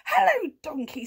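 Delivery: high, loud, bright-pitched cat hello; chirpy cat greeting. A narrator's reading. Cat greeting horse in the field, high cat voice. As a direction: call out as the storybook cat. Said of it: "said Kat".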